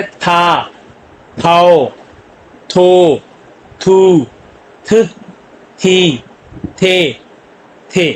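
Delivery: "था, थाओ, थो" with a breath puff on each थ, vowels held; aspirated sound. Shown as a demonstration. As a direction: drill थ syllables one by one, strongly aspirated. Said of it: "शुरू में aspirated sound ही देगा". ("था, थाओ, थो" में थ महाप्राण, हर अक्षर अलग)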